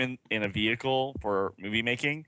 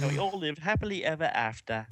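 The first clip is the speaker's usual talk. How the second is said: higher, put-on Cockney accent; 4 semitones higher than usual. Host closes the story for friends, mock Cockney. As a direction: end the fairy tale in mock Cockney.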